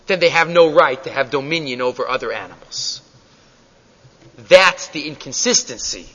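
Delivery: ordinary talk, nothing marked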